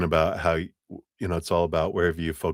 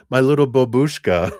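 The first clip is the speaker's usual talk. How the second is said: put-on loud, higher Russian-accented endearment; 7 semitones higher, 7 dB louder than usual. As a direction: coo a pet name like a Russian granny. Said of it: "coming through as a Russian".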